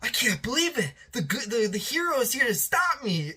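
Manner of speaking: high, starstruck villain voice; gushing fanboy. Retold or imitated. Megawatt thrilled the hero came to stop him, fanboying. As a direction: gush like a fan meeting his idol. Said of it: "He's like a fanboy almost".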